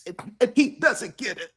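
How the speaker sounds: high, squeaky mock whine; mock whiny complaint. Imitating Rhonda complaining about Pat, mock high whine. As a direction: mimic a complainer, pitch shooting way up.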